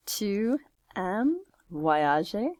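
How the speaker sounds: slow, separated French words, rising at the end; drawn-out question for writing down; word-by-word dictation. Shown as a demonstration.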